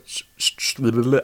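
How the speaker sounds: tired tongue-tied slurring; slurring words. Real, not acted. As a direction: stumble and slur the words. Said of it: "so I'm kind of slurring my words".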